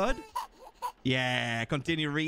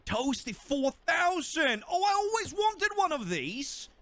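high-pitched excited exclamation; excited delight; 10 semitones higher than usual. Pretend. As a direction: gush over a new gadget, pitched high. Delighted at finding a toaster.

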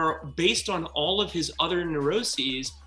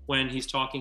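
ordinary delivery

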